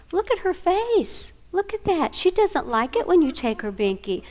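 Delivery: put-on high, slow voice for a small child; talking to a toddler. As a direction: coax a toddler to notice a friend's face.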